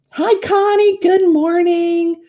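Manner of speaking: high, drawn-out cheerful hello; cheery greeting. Real, not acted. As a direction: sing out a bright welcome to a friend joining. Greeting a viewer who joins.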